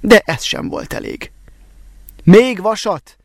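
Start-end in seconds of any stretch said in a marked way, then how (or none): 2.2-3.2 louder, higher call for more iron. A narrator's reading. The smith calls loudly for iron so the villagers outside hear. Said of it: "kiáltotta Szűcs Lajos, jó hangosan".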